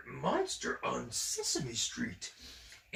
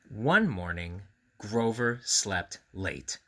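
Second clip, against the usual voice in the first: a louder, lively read-aloud; reading to children, lightly; story narration; 7 dB louder, slower than usual. A narrator's reading. Reading the Grover book aloud to children.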